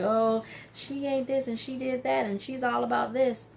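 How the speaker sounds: a hint of a catty gossip; mock catty girl. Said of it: "how girls be like".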